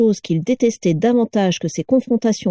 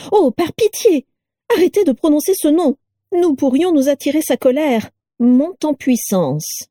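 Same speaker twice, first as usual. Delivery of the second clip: much higher fearful plea; panicky Carla; 8 semitones higher than usual. A narrator's reading. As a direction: beg them not to say the name. Carla voiced in story.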